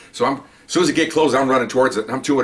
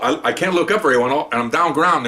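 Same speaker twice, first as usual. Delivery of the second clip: ordinary talk, nothing marked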